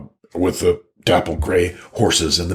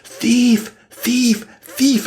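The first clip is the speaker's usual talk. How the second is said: high, loud cries of alarm; screaming thief; 3 dB louder, much slower than usual. Acted. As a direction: shriek it out to call the guards. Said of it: "So she screams".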